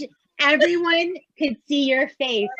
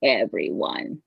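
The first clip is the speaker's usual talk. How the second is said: deep-pitched, measured trance-medium voice; Silver Cloud; 9 semitones lower than usual. Acted. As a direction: greet the room as an ancient channelled spirit. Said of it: "We have Silver Cloud coming through".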